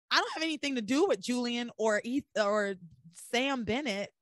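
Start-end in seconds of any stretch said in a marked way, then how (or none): none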